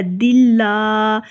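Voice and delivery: very slow دلہ with kasra, lam thin; دلہ, kasra on dal, light lam; slow thin dillah. Shown as a demonstration. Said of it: "دال کے نیچے زیر". (دلہ میں لام باریک)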